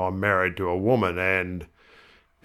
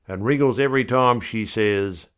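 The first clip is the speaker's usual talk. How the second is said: usual speaking voice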